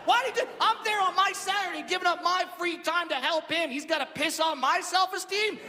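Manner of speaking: high-pitched comic outrage; indignant rant. Acted. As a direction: protest as if deeply wronged. Indignant rant about sick kid.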